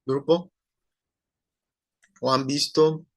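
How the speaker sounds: normal talking voice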